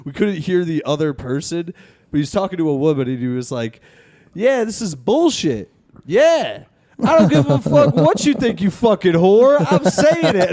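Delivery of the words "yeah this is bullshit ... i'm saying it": high, loud imitation of a ranting man; retold angry yelling. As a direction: act out his rant, building louder and higher. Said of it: "this guy yelling".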